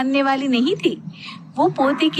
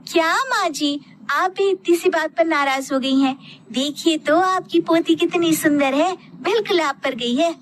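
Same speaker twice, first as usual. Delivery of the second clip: put-on higher, coaxing young wife; young daughter-in-law; 6 semitones higher than usual. A narrator's reading. Daughter-in-law soothes angry mother-in-law over baby girl, higher coaxing voice. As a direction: coax your mother-in-law gently.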